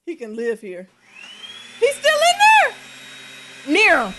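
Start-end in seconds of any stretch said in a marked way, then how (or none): 1.8-3.8 high, loud panicked cry; alarmed. Real, not acted. To partner about the spider still in there, high alarm. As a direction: call it out in sudden alarm.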